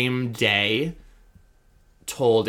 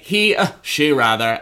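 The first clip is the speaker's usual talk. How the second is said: higher, louder fumbling correction; mock correction; 4 semitones higher, 8 dB louder than usual. Mocking a TV host misgendering a guest, higher and louder. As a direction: catch yourself mid-slip. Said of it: "kept being like".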